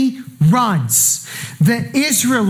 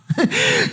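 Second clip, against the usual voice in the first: short high laugh; 8 semitones higher than usual. Real, not acted. Laugh after calling out.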